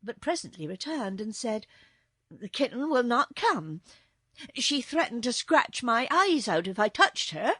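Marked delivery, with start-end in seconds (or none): 2.3-7.5 lightly voiced, louder maid; agitated maid. A narrator's reading. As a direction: report with mild alarm, a little higher.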